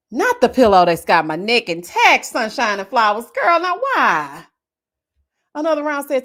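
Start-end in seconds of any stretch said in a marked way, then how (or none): 0.1-4.5 loud, dramatic pleading protest. Real, not acted. Protesting the pillow news.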